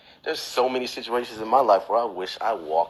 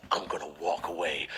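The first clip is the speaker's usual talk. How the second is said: low, much softer hero line; understated cool hero; 7 semitones lower, 13 dB quieter than usual. Acted. Someone imagining a magical exit, quietly cool. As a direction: say it low and quiet, coolly dramatic.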